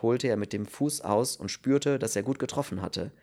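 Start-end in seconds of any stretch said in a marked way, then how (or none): none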